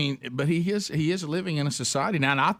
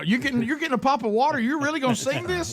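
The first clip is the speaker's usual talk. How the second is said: teasing excitement; 13 semitones higher than usual. Real, not acted. Teasing about singing.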